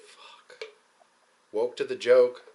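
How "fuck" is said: whispered curse, very soft; hushed exasperation. Acted. Reacting to recycled knowledge, hushed exasperated curse. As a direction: let it out under the breath.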